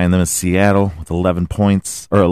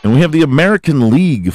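announcer intro; 7 semitones higher, 5 dB louder, much faster than usual. Acted.

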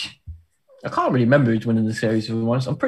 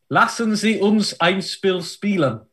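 put-on high, slow schoolroom German accent; drawn-out put-on German accent; best German accent; 6 semitones higher than usual. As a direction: announce the title in careful German accent. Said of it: "in my best German accent".

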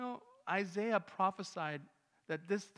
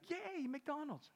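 high slow cheer; excited child; 12 semitones higher, much slower than usual. Retold or imitated. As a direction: squeal with a child's delight. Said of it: "she's like".

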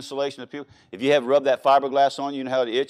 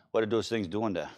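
softer, lower mock Cajun accent; 4 semitones lower, 7 dB quieter than usual. Preacher imitating French-speaking mama, Cajun accent. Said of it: "French folk don't pronounce the T H's".

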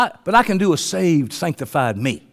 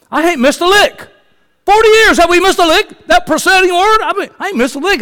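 preaching fervour; 9 semitones higher than usual. Real, not acted. Preaching at full force.

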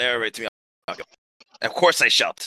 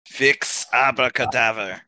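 ordinary talk

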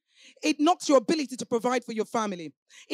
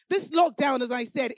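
usual speaking voice

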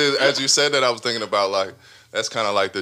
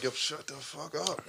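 stern hushed; 6 semitones higher, 11 dB quieter than usual. Retold or imitated. Mom hushing her loud child.

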